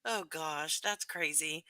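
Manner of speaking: normal talking voice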